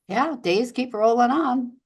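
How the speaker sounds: ordinary talk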